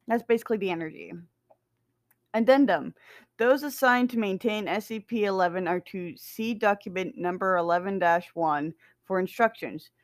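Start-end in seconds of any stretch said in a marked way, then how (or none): none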